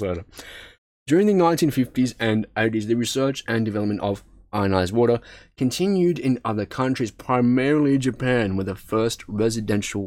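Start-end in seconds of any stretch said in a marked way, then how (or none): none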